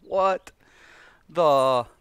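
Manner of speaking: loud, high mock disbelief; incredulous. Streamer retells her reaction to the healer. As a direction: throw the words out in disbelief. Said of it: "You're like".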